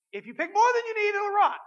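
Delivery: high, loud stern warning; preacher booming God's stern warning. Acted. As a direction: belt out God's warning about rot. God's warning voiced in sermon.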